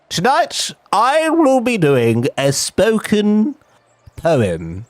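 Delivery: high, slow, theatrical announcing voice; grand announcement. Retold or imitated. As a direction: announce it like a solemn performer. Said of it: "And she goes".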